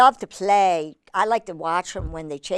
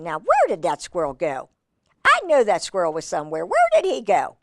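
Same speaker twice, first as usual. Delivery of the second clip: baffled hawk. Acted. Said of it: "He's saying".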